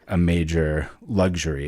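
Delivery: normal talking voice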